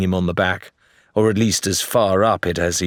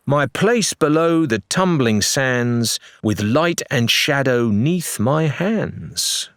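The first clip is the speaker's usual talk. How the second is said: slow, higher, measured verse reading; reading a riddle aloud; 5 semitones higher, slower than usual. Acted. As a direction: recite it like a mysterious rhyme, slowly. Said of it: "read the new directions out loud".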